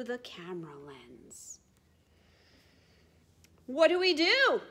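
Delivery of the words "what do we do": high, loud shouted question; penguins shouting. Acted. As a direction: shout it together. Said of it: "shout all the other penguins".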